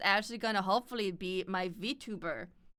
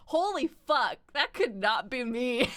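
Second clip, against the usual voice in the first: higher, awestruck outburst tipping into laughter; mock amazement; 4 semitones higher, 4 dB louder, slower than usual. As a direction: marvel in disbelief, then crack up. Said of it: "I'm like".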